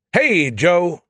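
high, loud hailing shout. A narrator's reading. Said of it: "he shouted".

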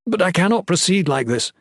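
high, urgent protest; pleading. A narrator's reading. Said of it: "he pled".

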